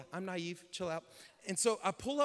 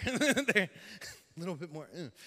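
ordinary talk

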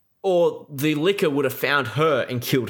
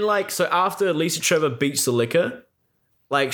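usual speaking voice

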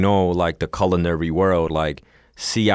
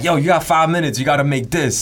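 higher-pitched, punchy mock command; pretend challenge order; 6 semitones higher, 3 dB louder than usual. Imagined chef testing a job applicant, mock urgent order. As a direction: put on a test-giver issuing a challenge.

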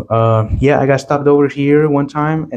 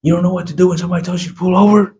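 fast, higher mock cop voice; sneering cop, fast and high; 7 semitones higher, much faster than usual. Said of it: "and he's like".

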